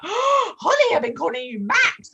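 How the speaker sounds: gasping realisation in a high voice; shocked surprise. Retold or imitated. Shock at a daughter, not son.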